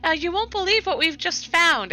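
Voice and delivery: high-pitched eager sharing; excited gushing. Acted. Althea telling Cassandra about their finds, excited. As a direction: gush with news, voice lifted high.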